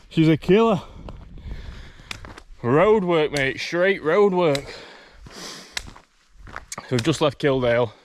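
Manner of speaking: out of breath on the climb; panting between words; heavy breathing. Real, not acted. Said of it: "Excuse the heavy breathing".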